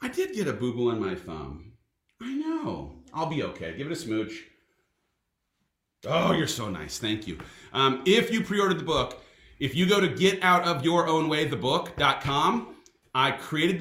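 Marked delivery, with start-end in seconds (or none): 0.0-7.4 tender and soft. Real, not acted. Dad showing his son a sore thumb, soft and tender. Soothing talk with child about thumb.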